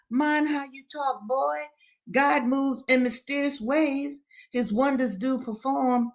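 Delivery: put-on high, preachy old man; warning Daddy Joe. A narrator's reading. Old man warns Henry with scripture, reproving. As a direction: caution him in a high-pitched pious voice.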